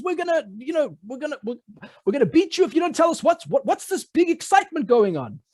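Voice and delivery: high, fast threatening guard; guard's threat. Acted. Prison guard threatening the dancing prisoners, high and fast.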